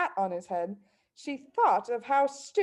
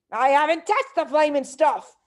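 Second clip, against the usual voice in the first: louder, higher angry denial; shouted; 6 semitones higher, 5 dB louder than usual. A narrator's reading. Husband shouting at wife about the glue, louder. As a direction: snap back in outrage. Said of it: "Mister Wormwood shouted".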